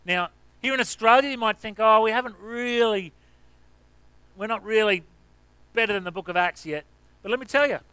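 rally them toward revival; preaching at full force. Acted. Pastor proclaiming revival to Australian church, building preaching.

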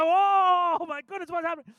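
very high, loud amazed whoa; overwhelmed, can't believe it; astonished cry. Retold or imitated. Passenger overwhelmed on the plane, high astonished cry.

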